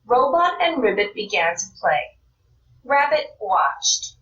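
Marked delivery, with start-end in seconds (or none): none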